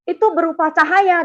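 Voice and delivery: teguran tiruan bernada agak tinggi; menirukan teman marah. Retold or imitated. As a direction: tirukan sisa kekesalan teman. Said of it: "dia marah saya". (membantah dengan sedikit gusar)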